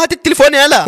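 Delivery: high-pitched acted demand; cross demand. Girl demanding her phone from the boy, cross.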